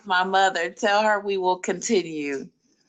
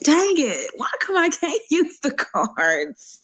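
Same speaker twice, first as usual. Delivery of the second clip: whining, half laughing; a playful pout; whiny frustration; 6 semitones higher than usual. Real, not acted. Frustrated over the cards.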